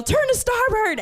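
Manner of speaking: yelled high command to starboard; retold yelled helm order. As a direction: bark the new heading at the crew. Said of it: "So I have to yell".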